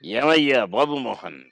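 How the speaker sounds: slow, teasing imitation of a senior star; imitating Mohan Babu. Asked by the host, actor imitates senior star teasing him. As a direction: drawl out the star's mock scolding. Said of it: "ఎలా అంటారు sir ఆయన?".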